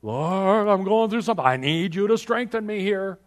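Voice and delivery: high, loud desperate plea; pleading prayer. Retold or imitated. Preacher acting out a desperate plea for strength. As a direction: cry out to the Lord for strength. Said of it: "not an accurate prayer".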